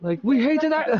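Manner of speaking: very high mock Irish voice; squeaky hammed-up brogue; Irish accent. Friends joking, squeaky Irish voice.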